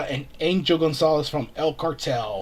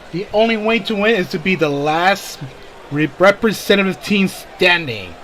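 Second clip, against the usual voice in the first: ring announcer; 4 semitones higher, 6 dB louder than usual. Acted. Rules read in announcer voice.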